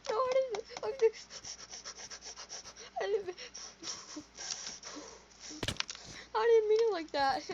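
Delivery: high, halting plea; imitated tearful excuse; mock whimpering apology. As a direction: stammer it out like a boy in trouble. Boy whimpers apology in retelling.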